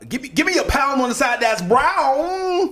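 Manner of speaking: high, loud, outdated jive voice; mock American accent. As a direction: ham up old-time American slang. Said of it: "can you do a, uh, an American accent".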